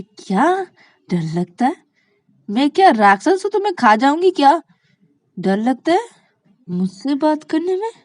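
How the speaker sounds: high, loud disbelieving questions; incredulous outcry. Acted. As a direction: challenge her in loud astonishment. Shreya incredulous at being feared.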